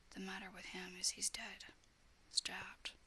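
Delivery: plain reading voice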